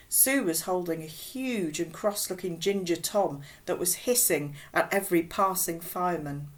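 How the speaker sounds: ordinary delivery, nothing marked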